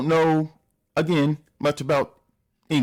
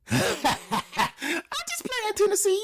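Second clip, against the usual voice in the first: high laughing apology; much slower than usual. Real, not acted.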